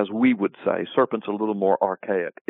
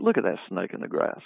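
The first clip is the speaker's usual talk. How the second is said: softer, lower, knowing remark; sly aside; 5 semitones lower, 6 dB quieter than usual. Retold or imitated. At a crowded party, pointing out a deceiver to a friend, sly and quiet. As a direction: murmur it to a friend about a backstabber at the party. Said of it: "if we're in a room, a big party".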